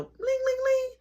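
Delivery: high sung doorbell chime; ding like a doorbell; mock doorbell ring; doorbell imitation.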